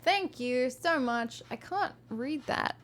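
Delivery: plain reading voice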